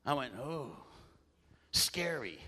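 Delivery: ordinary talk, nothing marked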